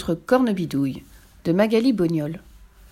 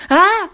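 high, loud yelp of fright; short shriek on jolting awake; startled cry; 12 semitones higher, 9 dB louder than usual. A narrator's reading. Pierre waking before two witches, startled cry. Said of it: "s'écria Pierre en sursaut".

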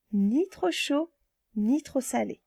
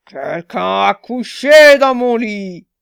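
slow, much louder rumbling growl; growling big bear; 12 dB louder, much slower than usual. Acted. Big bear finding his bed used, slow loud growl. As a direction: roar it out like a huge angry bear. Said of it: "le grand ours gronda".

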